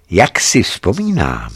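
usual speaking voice

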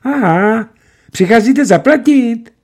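high, loud, eager little clerk; delighted. A narrator's reading. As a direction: brighten at the thought of money. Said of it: "očka mu radostně zamžikala". (radostně, vysoko)

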